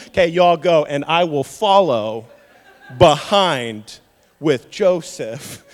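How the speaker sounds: loud and slow, laughing through it; slow, loud, laughing mock Jacob; mock cowardly Jacob. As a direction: send them ahead and chuckle. Jacob voiced sending family ahead.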